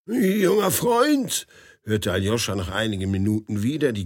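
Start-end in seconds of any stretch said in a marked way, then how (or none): 0.1-1.5 put-on high, slow old man's voice. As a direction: drawl it like a fussy old gentleman. Old man calls Aljoscha.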